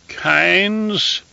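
put-on high, thin voice of an old emperor; a reedy, scratchy old ruler calling out. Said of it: "in a reedy, scratchy voice".

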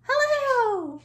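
high, loud, drawn-out hello. Shown as a demonstration. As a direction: pitch it way up at the top.

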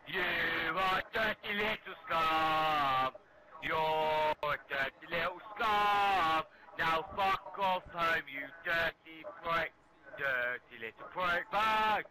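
loud, high, drawn-out angry shout; real mad shouting. Real, not acted. Gamer throwing a liar out of his party, mad shouting. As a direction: yell each insult slowly, stretching the words out. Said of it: "Why are you mad".

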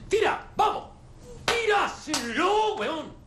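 very high, straining yell; furious shouted orders. Acted. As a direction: scream orders at someone who hesitates. Ordena a gritos y amenaza. (órdenes furiosas a gritos)

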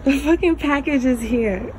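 shocked the package is actually there; surprised exclamation. Real, not acted. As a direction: blurt out the discovery.

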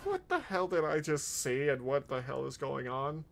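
normal talking voice

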